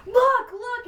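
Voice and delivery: high, loud excited urging. Retold or imitated. Friend spotting the drummer, excited high call. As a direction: point and squeal it out.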